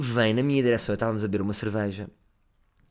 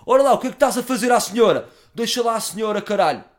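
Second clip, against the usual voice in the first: much higher, louder hard man, still roaring; tough-guy voice; 11 semitones higher, 9 dB louder than usual. Retold or imitated. Corrected version, telling the drunk to leave the lady. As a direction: bark it big but say senhora. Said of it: "Não disse gaja, disse senhor".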